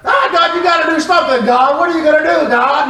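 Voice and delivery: high, frantic pleading to God; mock panic. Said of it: "a little freaked out".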